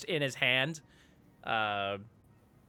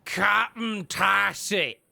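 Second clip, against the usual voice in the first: slow, loud, raised proclamation; booming voice; 7 semitones higher, 7 dB louder, much slower than usual. Acted. Dragonborn answers who is asking, slow and booming. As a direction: draw the name out loudly. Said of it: "large, like, booming, uh, voice".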